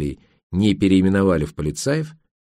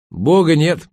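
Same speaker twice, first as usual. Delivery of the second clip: higher, proudly declared; proud declaration; 7 semitones higher, 4 dB louder, slower than usual. A narrator's reading. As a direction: announce it with defiant pride. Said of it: "гордо встала на пороге". (гордо заявляя)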